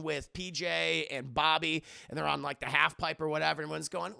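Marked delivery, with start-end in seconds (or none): none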